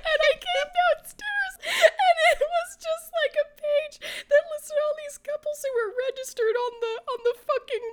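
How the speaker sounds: high, laugh-choked telling; laughing while talking. Real, not acted. Telling a friend the story, laughing helplessly. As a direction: push the words through helpless laughter.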